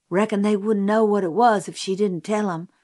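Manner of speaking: ordinary delivery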